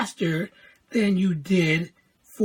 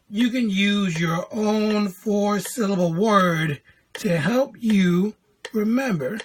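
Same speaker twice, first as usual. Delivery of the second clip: usual speaking voice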